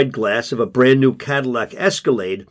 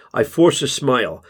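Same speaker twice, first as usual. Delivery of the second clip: usual speaking voice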